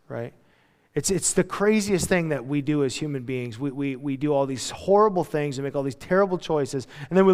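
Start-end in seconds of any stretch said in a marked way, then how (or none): none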